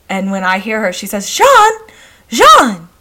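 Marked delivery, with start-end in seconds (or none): 1.3-2.9 put-on high, loud English-accented call of a mother; irate English accent. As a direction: put on an imperious English accent, calling out sharply. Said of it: "she speaks in an English accent".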